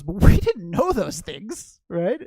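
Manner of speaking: loud outburst with a laugh; amused, laughing. Real, not acted. Teacher joking to listeners about learning to drive, amused and laughing.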